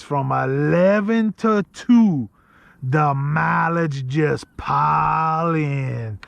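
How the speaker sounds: put-on very slow, drawn-out Louisiana accent; thick Louisiana accent. Retelling the old hunter's advice, imitated thick Louisiana accent. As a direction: stretch every word in a thick Louisiana drawl. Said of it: "with the accent, thick accent".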